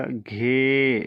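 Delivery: "घे" with its long e drawn out slowly; long ghee-e. Shown as a demonstration. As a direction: stretch the e in "घे". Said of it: "यानी इसे खींच कर पढ़ना पड़ेगा".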